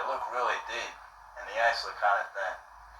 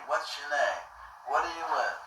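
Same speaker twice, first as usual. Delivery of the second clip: suspicious homeowner voice; 5 semitones higher, 5 dB quieter than usual. Retold or imitated. Homeowner keeps asking.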